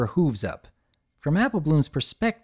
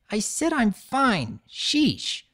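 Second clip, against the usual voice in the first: annoyed; 9 semitones higher, much slower than usual. Acted. Hiding bruise, annoyed.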